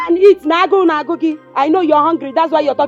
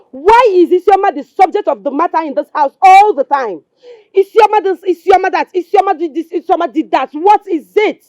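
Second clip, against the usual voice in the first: angry rant, raised and pushed; exasperated tirade; 2 semitones higher, 3 dB louder than usual. Acted. Wife fed up with husband defending Isioma, exasperated. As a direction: fire off complaints, voice raised.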